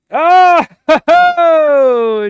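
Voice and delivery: very high, loud whoop into laughter; shrieking ho ho at the surprise winner; surprised whooping laugh. Real, not acted. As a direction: burst out laughing at the upset. Surprise upset at the finish.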